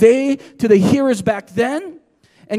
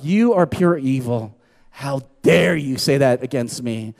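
low-pitched, accusing mock indignation; play-acted outrage, pitched down; mock condemnation; 9 semitones lower than usual. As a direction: condemn them in a lowered, indignant voice. Acted condemnation of other people.

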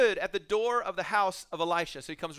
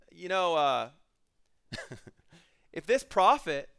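usual speaking voice

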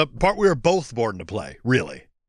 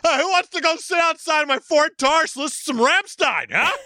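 mock bro invite; 12 semitones higher than usual. Mocking 2001 car-lot bro.

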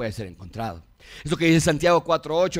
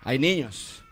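normal talking voice

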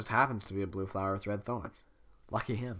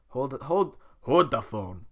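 Donkey voice; 5 dB louder, slower than usual. Acted.

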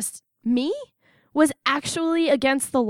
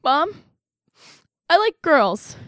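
loud, higher-pitched acted blurt; blurted confession; 4 semitones higher, 6 dB louder, much slower than usual. Drunk daughter coming out to her mom, blurting it out. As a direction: burst out with it after a halting start. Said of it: "it just blurted out".

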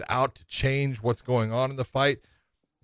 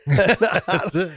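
laughing; 7 semitones higher, 7 dB louder than usual. Real, not acted. Laughing at a joke.

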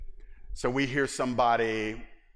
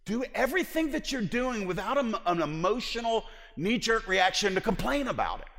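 much higher, louder quoting of scripture; emphatic quote; 11 semitones higher, 5 dB louder than usual. Retold or imitated. Preacher quoting Paul to the church, high emphatic. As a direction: lift it high, quoting Paul's command. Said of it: "But he says".

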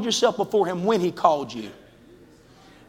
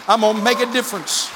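building preach; 6 semitones higher than usual. Acted. Preacher voicing the church member's vow to the congregation, building to a cry. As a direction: build each promise until it bursts out.

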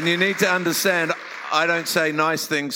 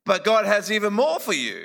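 raised, earnest sermon voice; preaching; 5 semitones higher than usual. Acted. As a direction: preach it to the congregation. Preaching through an interpreter.

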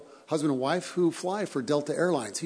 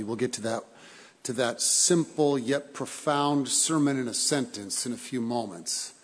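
ordinary delivery

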